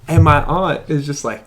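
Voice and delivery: ordinary delivery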